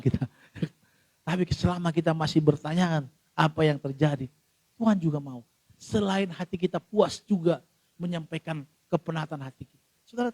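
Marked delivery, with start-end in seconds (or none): none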